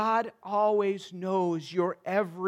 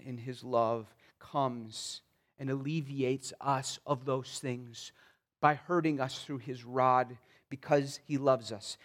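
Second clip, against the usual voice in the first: normal talking voice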